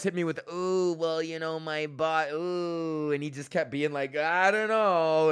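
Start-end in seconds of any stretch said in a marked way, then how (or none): 0.5-3.1 mock a useless excuse-maker, stretching the vowels; mocking whiner. Student mimicking the hedging IT guy, mocking whine. 4.2-5.3 slow, higher and louder dopey voice; mocking whiner. Student mimicking the clueless IT guy, mocking whine.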